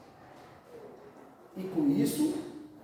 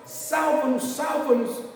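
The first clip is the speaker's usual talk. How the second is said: raised, higher mock crowd chant; retold cries for rescue, pitched up and loud; imitated crowd shouting; 5 semitones higher, 4 dB louder than usual. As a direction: call out like a clamouring crowd. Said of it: "quando aquele povo gritava".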